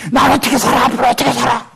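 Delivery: anguished mock outcry rising to a yell; despairing cry. Celebrity despairing about the future, anguished outcry.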